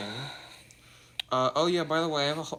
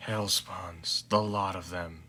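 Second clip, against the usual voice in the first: lightly voiced weary father, flat and dry; deadpan tired dad; 7 semitones lower, 7 dB quieter, slower than usual. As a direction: grumble it like you can't be bothered.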